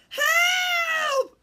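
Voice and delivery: very high, loud storybook shriek; cry for help. A narrator's reading. Birds crying as the fox leaps, high panicked shout. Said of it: "they all cried".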